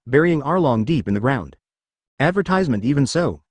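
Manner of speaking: plain reading voice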